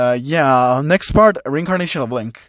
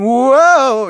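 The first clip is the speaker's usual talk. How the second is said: excited whoa; 11 semitones higher, 7 dB louder than usual. Acted. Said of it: "President Mike saying".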